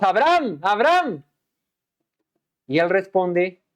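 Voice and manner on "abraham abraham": called out, a little higher; calling out twice. Acted. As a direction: call him from heaven, twice. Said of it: "lo hace no una, sino dos".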